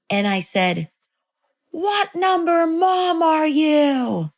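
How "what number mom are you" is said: high, slow, drawn-out child's demand, imitated; intense demand. Child staring at mom, imitated high slow intense demand. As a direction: play an eerie intense kid questioning mom, each word stretched. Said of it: "I looked at her really intensely".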